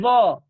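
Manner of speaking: za said loud, tongue tip to upper teeth; Arabic letter za. Shown as a demonstration. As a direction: show the za sound clearly. (za diucapkan dengan ujung lidah di gigi atas)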